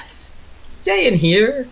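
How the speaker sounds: lightly voiced concerned aunt; cautioning aunt. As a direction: caution the kids as their aunt. Voicing Melba cautioning the kids.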